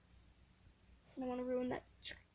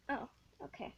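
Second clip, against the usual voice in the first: normal talking voice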